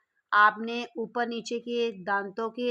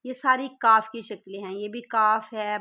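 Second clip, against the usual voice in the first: usual speaking voice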